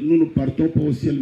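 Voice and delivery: ordinary talk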